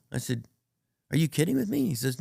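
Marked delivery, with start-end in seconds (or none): 1.1-1.9 high, loud disbelieving question; retold incredulity. Comic replaying his reply to the singer, incredulous. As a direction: act out being flabbergasted, pitch jumping up.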